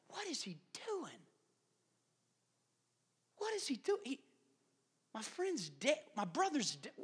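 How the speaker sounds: soft voice with high swooping pitch; bewildered mourners. Acted. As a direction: puzzle under the breath in stunned grief. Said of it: "What was Mary and Martha thinking?".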